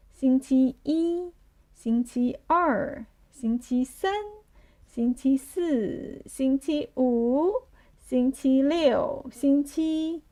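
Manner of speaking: slow, drawn-out weekdays with held last syllables; slow group recitation. Shown as a demonstration. Teacher leading the class through weekdays, slow recitation. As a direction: recite slowly so learners can join. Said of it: "say Monday to Sunday together".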